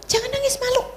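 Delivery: lightly voiced nagging parent; mock scolding parent. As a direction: mimic a parent shushing a crying girl. Parent scolding crying daughter.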